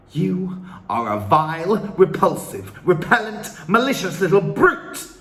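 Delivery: loud, high furious insults; shouting. A narrator's reading. Trunchbull shouting insults at Matilda. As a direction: spit each insult out. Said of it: "the Trunchbull was shouting".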